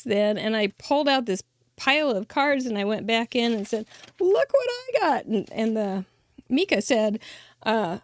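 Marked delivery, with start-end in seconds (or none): 4.2-5.2 retold proud boast, pitched way up; excited boast. Showing the post office clerk her pile of cards, excited and high. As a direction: brag about the stack of cards, voice soaring high.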